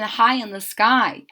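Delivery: usual speaking voice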